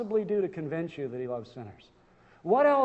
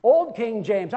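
ordinary delivery

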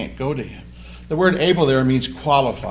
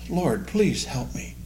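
quiet plea; 6 dB quieter, slower than usual. Shown as a demonstration. Preacher showing a prayer in hard times, soft and slow. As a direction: ask slowly and humbly.

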